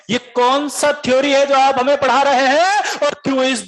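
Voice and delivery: high, slow indignant protest. Retold or imitated. Student challenging the teacher in a high, slow indignant protest. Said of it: "बोला गया एकदम।".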